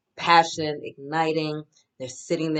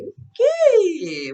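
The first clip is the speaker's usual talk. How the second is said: high, loud squealed okay; excited squeal; 9 dB louder than usual. Real, not acted. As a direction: squeal it with delight. Excited reaction to the cards.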